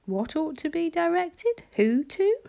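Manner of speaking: put-on high, lightly weakened boy's voice; high-pitched, rising, mildly feeble questions. A narrator's reading. Injured boy suddenly questions his helper, very feebly. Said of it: "asked the boy suddenly and very feebly".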